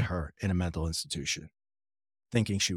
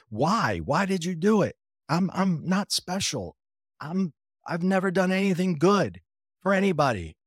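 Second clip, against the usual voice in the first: emotional plea; 8 semitones higher than usual. Retold or imitated. Pleading with his spirit guide.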